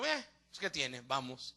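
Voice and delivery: soft, low shrug of agreement; low, half-hearted acceptance; offhand giving in. Retold or imitated. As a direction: give in quietly, as if it hardly matters. Acting out his reluctant giving in.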